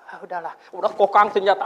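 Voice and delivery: high, laughing retold resignation; laughing quote. Preacher retelling the brother giving up, laughing. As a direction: laugh through the brother's words. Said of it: "dia bilang".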